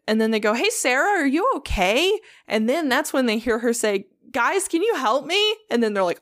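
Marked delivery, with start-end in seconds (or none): 0.5-2.2 high, singled-out call to someone downstairs; raised-pitch mock call of concern. Quoting team calling down to Sarah. 4.3-5.6 high-pitched imitation of a plea; calling for help. Quoting woman calling for help.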